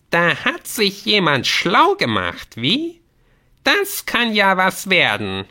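high, slow, loud gnome voice; grudging admiration from a squeaky gnome; impressed, mocking gnome. (beeindruckter Gnom, hoch und gedehnt)

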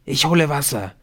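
calling out. A narrator's reading. Masked figure bursts out of the door, calling out. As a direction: call it out while rushing off. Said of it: "rief der".

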